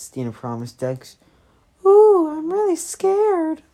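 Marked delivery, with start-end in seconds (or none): 1.8-3.6 high, loud, slow mock fright; mocking scared. Shown as a demonstration. Dex mocks Stina's threat in a hammed-up high falsetto. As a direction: sneer it in a falsetto of fake terror. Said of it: "that's not how you s-, what it sounded".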